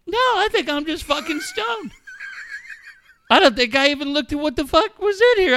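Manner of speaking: high-pitched, dawning alarm; mock panicked realization. Man realizes he is just stoned, mock alarm.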